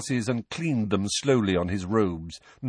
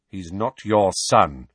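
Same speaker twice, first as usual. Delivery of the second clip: plain reading voice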